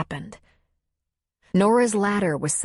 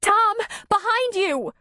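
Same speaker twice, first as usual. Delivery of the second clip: much higher, slow alarmed cry; panicked warning yell; slower than usual. Acted. Woman warns Tom of a bear cub, panicked. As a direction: warn him in fear.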